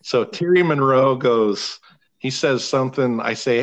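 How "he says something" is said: usual speaking voice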